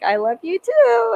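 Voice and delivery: high, slow, gushing I love you too; slow high fangirl gush; gushing mock reply. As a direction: swoon it out like a fan. Retold reply to star.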